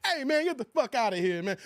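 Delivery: normal talking voice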